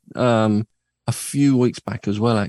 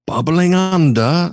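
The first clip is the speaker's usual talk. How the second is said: chart announcer; 6 semitones higher, 4 dB louder, slower than usual. Acted. Chart countdown announcer phrasing.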